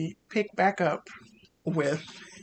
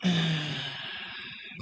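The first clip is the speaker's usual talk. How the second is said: creepy long sniff-sigh; 6 dB quieter than usual. Acted. Creepy presence over sleeper.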